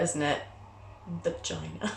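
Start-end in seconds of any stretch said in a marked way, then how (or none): 1.2-1.9 half-whispered; mock-fearful hush; scary word. Shown as a demonstration. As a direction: say the dreaded word softly. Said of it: "That's the scary word".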